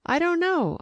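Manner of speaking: high, light and smiling; amused smiling reply; with a smile. A narrator's reading. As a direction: brush it off with a smile. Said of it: "I said with a smile".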